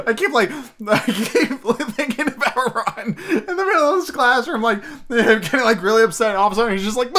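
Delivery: high, breathless, half-laughing talk; giggling through it. Real, not acted. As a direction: still giggling as he tries to explain. Giggling while explaining the joke.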